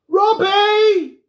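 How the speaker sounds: high, loud call across the house. A narrator's reading. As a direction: shout a name to someone far off. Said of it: "She stopped uncertainly".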